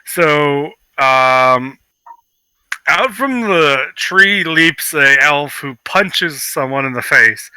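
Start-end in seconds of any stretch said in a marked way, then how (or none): none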